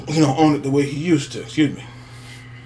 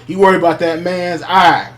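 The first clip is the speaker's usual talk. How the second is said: ordinary delivery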